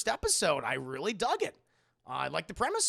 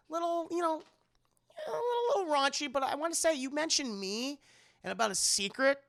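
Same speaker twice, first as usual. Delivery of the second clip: high, hesitant fluttery voice; flustered aunt voice; 9 semitones higher than usual. Retold or imitated. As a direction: squeak out the awkward hint. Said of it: "But she goes".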